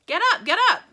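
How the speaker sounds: high, loud jolly urging; loud cheerful cry. A narrator's reading. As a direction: call them up, full of glee. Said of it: "cried Mister Wonka, roaring with laughter".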